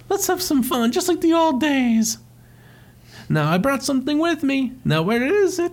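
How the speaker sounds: high cheery cartoon Mario; Mario's voice. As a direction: chirp it up high like old times. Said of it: "And he goes".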